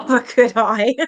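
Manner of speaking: slow, loud, laughing through the words; real laughing. Real, not acted. Reader struggles to continue the story, laughing. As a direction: barely get the question out for laughing.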